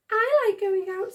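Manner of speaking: high, cheerful small voice; new little voice. Acted. Another sock chiming in to join, high and cheerful. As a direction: chime in brightly. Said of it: "said a voice".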